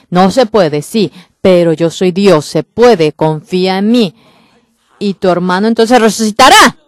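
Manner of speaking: Jesus answering doubting sisters, loud and firm. Acted. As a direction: answer the doubt with full conviction. Preacher voices Jesus answering doubt.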